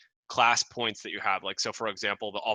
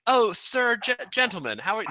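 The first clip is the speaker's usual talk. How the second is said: 1 mock-polite kobold; 8 semitones higher than usual. GM voices the kobold leader.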